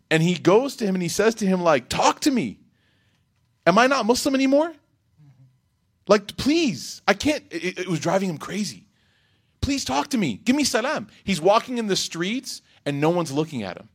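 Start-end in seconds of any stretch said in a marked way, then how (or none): 1.9-7.4 high, pleading retold cry for help; a desperate man pleading with his cousin to speak to him; desperate plea. 9.6-11.1 high, pleading retold cry for help; a desperate man pleading with his cousin to speak to him; desperate plea.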